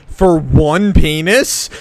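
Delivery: milk every word of "For one penis?"; replaying the punchline slow and loud for the video opener; drawn-out quote. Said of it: "the first thing of the video is".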